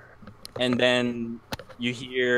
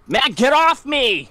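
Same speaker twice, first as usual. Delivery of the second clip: much higher, loud cry to get free; younger voice struggling; 14 semitones higher, 9 dB louder than usual. Acted. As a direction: shove off an attacker, shouting. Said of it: "you hear the younger voice go".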